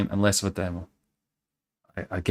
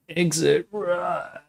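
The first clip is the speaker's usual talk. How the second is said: drawn-out; 8 semitones higher, much slower than usual. Pretend. Reading sign in drawn-out voice.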